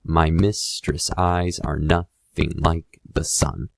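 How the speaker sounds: slow, beat-by-beat "My mistress' eyes are nothing like the sun", every second syllable stressed; exaggerated iambic emphasis. Shown as a demonstration. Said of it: "read the words with some extra emphasis".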